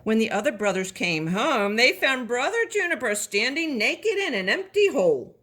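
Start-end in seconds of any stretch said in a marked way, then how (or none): none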